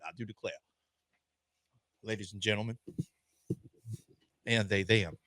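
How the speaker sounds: soft, low Southern drawl; Southern lawyer drawl. Acted. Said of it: "Thank you, Southern Lawyer".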